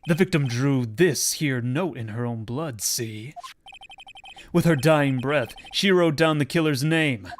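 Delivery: loud, gravelly tough-guy cop; gravelly detective voice. Acted. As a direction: growl it like a gruff old detective. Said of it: "Doing those gravelly voices is killing me".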